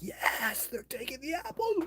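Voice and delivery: high, thrilled squeal; excited squeal. Retold or imitated. Thrilled that Sasquatch takes his apples, squealing high. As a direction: squeak it out like a giddy kid. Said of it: "I just get so excited".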